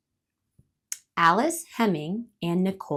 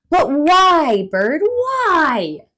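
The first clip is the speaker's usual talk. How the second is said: much louder, high pleading why; whining squirrel; 8 semitones higher, 11 dB louder, much slower than usual. Acted. Loud whining squirrel. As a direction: squirrel begs bird for a reason, whiny.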